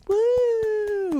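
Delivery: high, long cheering woo; mock cheer woo. Guests cheering the couple with a high drawn-out woo. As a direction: whoop it up like a wedding crowd.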